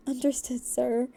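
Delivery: plain reading voice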